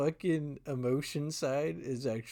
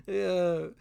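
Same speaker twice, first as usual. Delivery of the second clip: ordinary delivery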